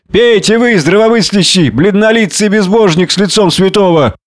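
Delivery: high-pitched taunting insults; mock the sober saint, pitched high; drunken jeering. A narrator's reading. Drunken man taunts sober Prendick with brandy, jeering.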